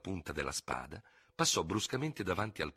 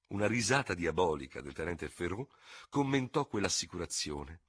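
plain reading voice

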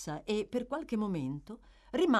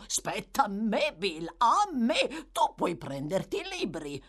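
slow, louder, drawn-out claim; 4 semitones higher, 5 dB louder than usual. A narrator's reading. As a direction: stretch out the insistence greedily.

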